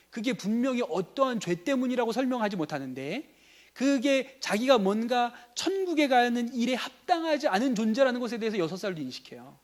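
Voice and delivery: ordinary talk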